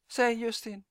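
plain reading voice

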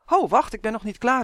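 urgent call. A narrator's reading. Justin stops Malien before she turns on, calling out. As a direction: call a halt hastily. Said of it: "riep Justin".

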